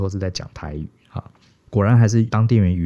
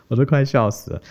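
laughing; 6 semitones higher than usual. Real, not acted.

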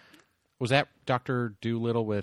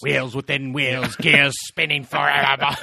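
slow, rising, louder mystic chant; dramatic intoning; 4 semitones higher, 8 dB louder, slower than usual. Pretend. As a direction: intone it like a cosmic prophecy.

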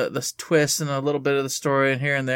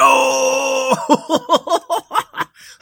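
shrieking high clown laugh; loud giddy clown cackle; 10 semitones higher, 7 dB louder than usual. Acted. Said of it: "Never clown voice".

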